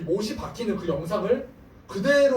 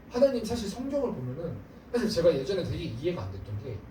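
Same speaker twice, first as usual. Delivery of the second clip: normal talking voice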